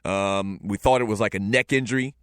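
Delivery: ordinary delivery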